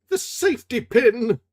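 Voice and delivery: cry of surprise. A narrator's reading. Remy crying out at the pin in his hand. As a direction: gasp it out in shock. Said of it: "cried Remy".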